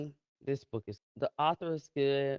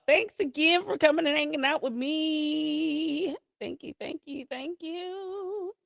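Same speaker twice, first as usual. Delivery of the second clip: high, sing-song thanks; sing-song sign-off; 13 semitones higher than usual. Real, not acted. Streamer thanking viewers for hanging out, sing-song sign-off.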